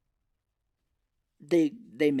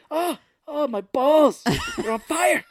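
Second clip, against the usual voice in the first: high, loud, hammed-up yelp; mock pained yell; 13 semitones higher, 7 dB louder, slower than usual. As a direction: cry out as if scalded, exaggerated. Retells man scalded by coffee.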